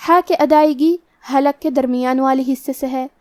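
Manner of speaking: ordinary talk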